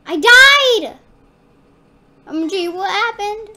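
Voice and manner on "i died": high, loud cry of dismay; shocked gamer wail; dismayed death yell. Real, not acted. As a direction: yell it out as you die in the game. Character dies in game.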